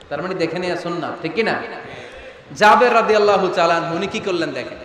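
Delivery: ordinary talk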